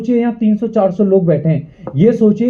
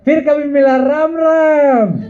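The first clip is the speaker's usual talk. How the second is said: drawn-out, higher-pitched mock farewell; mocking sing-song; 6 semitones higher, slower than usual. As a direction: stretch it out like a teasing chant. Mocking chant about quitters.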